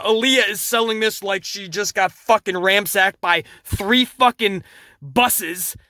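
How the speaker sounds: ordinary delivery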